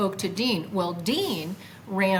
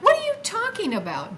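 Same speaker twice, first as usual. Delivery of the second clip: much higher, baffled; incredulous; 12 semitones higher, 4 dB louder than usual. Retold or imitated. Confronting Dean's claim nobody meets him, baffled. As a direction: thrown by his answer.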